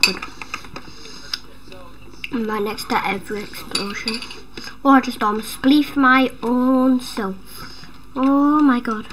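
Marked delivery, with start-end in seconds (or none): none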